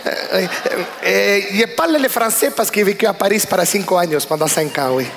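put-on lightly Spanish-accented French, rolled r; Colombian accent. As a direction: play a Colombian guy named Manuel introducing himself. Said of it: "je vous montre".

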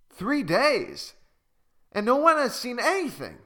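high, quick disbelief; shocked that nobody noticed; astonished exclamation. Acted. Okazu exclaiming in disbelief at Malu's story. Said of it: "exclaimed Okazu".

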